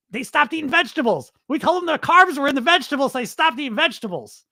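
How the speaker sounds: high, squeaky gleeful bragging; hammed-up giddy squeal; mock hysterical executive. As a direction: gloat as if barely holding in giggles. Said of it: "they're laughing so hard".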